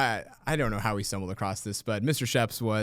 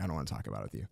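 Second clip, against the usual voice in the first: much lower and softer, sulking; mock sulky mumble; 11 semitones lower, 9 dB quieter, much faster than usual. Teacher to students, faking a hurt sulk, low and soft. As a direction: mutter it like a wounded friend. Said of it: "he's like".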